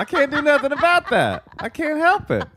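squeaky high laughing through the words; giggling it out, voice way up. Real, not acted. Laughing at his own joke.

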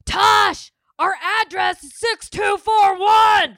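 high, loud, slow mock correction; mocking husband shouting the address at his wife; imitating her husband. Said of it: "you're like".

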